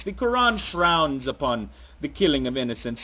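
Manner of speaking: put-on muddled accent, half Russian, half Transylvanian vampire.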